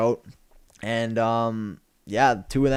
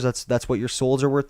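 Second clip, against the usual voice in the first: ordinary talk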